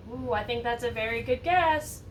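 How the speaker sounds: ordinary delivery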